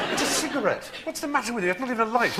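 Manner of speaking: very high, pleading protest; hammed-up exasperated squeak; mock exasperation. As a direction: scold a jumpy horse in comic despair. Exasperated rider scolding spooked horse.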